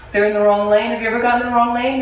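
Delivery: ordinary talk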